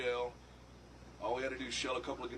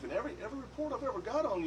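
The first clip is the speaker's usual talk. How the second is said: quick, higher gushing cop praise; friendly cop impression; 8 semitones higher, faster than usual. Cop praising [name]'s reputation, fast and lightly raised. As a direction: rattle off the praise like an eager fan cop. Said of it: "he's like".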